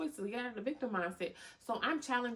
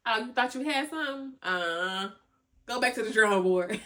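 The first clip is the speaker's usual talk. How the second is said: loud, sassy brush-off, getting louder; sassy comeback; 5 dB louder than usual. Pretend. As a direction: put the gossiper in their place.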